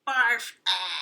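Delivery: loud gagging "Barf"; grossed-out hamster; disgusted. Acted. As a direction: spit it out in disgust.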